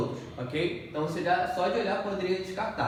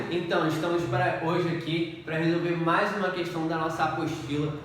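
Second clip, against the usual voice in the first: ordinary delivery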